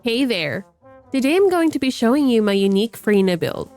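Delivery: ordinary talk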